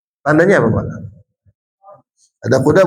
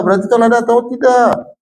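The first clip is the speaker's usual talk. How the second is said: lightly voiced sweet teacher, higher; kindergarten teacher voice; 7 semitones higher than usual. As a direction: talk sweetly to small children. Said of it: "seorang guru mengatakan gini".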